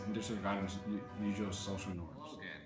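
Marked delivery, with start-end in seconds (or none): none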